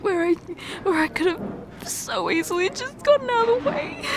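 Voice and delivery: high, breaking sobs with a cry at the end; sobbing hard. Acted. Reliving the train moment for her partner, sobbing hard. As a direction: let the sobs break the words apart. Tearful confession to injured partner.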